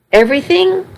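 "Everything?" with a sharp, high questioning rise; "Everything?" asked back in startled doubt, rising high; questioning disbelief. Acted. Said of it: "Everything else was the truth".

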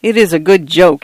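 ordinary delivery, nothing marked

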